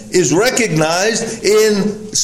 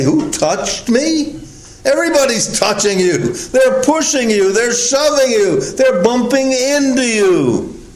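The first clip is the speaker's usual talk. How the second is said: high-pitched, exasperated disbelief; incredulous protest; 6 semitones higher than usual. Acted. Disciples answering Jesus in the pressing crowd, incredulous. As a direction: protest it as if the question were absurd.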